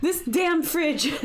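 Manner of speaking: slow, laughing groan at the fridge; laughing exasperation. Real, not acted. Streamer telling viewers about her fridge ordeal, laughing and exasperated. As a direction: drag it out through a laugh.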